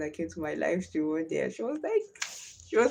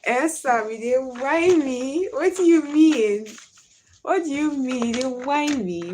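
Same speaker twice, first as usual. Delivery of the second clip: loud, raised Warri Pidgin challenge; mock-indignant comeback in a Warri accent, pitched up; 6 semitones higher, 6 dB louder than usual. Shown as a demonstration. Streamer challenging Esther for doubting her, in loud Warri Pidgin. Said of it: "Why I no sabi speak Pidgin English".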